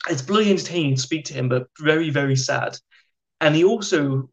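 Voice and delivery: ordinary delivery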